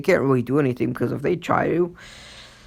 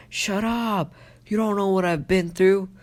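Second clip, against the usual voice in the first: angry retort; 8 semitones higher than usual. Retold or imitated. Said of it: "And he's like".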